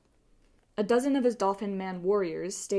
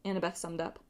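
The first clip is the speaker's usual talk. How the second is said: ordinary delivery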